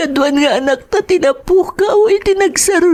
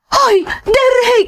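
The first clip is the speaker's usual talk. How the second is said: slow drawn-out call, a bit higher; 5 semitones higher, slower than usual. Acted. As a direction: call him back urgently from across the room. Wife calls husband urgently. (napigsa a panangayab ken ni Derek)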